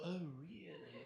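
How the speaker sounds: slow, drawn-out English "oh, really?"; English accent. Acted. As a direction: ooze English accent, stretching the words. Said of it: "with my English accent".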